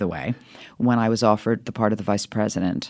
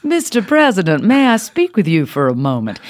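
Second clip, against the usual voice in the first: put-on Southern accent; 6 semitones higher, 4 dB louder than usual. Actress improvising Southern vice president for the President, Southern accent. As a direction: put on a North Carolina lady's Southern accent. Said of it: "She's Southern".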